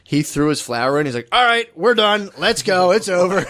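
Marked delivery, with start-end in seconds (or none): 1.3-3.5 raised, higher-pitched imitation of wrapping things up; brusque wrap-up. Grandfather at a funeral after his flower, imitated brusque dismissal. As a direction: announce it's over, impersonating an impatient elder.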